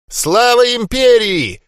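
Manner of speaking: slow, high, loud hoarse croak. A narrator's reading. Old Лебле croaking a loud slow hoarse salute. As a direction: rasp out the cheer. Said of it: "прохрипел Лебле".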